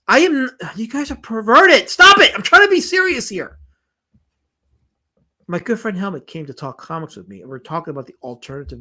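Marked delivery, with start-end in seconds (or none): 0.1-3.6 high, loud pleading scold; exasperated outburst. Real, not acted. Streamer telling chat he's trying to be serious, exasperated outburst. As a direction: beg them loudly to behave. Exasperated at chat's dirty jokes.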